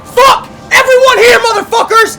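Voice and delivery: all-out screamed battle cry; screaming. Real, not acted. Coach screams at team in huddle.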